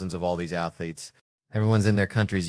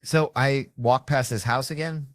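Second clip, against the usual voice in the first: ordinary talk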